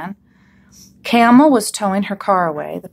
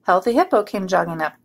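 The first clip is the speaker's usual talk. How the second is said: ordinary delivery, nothing marked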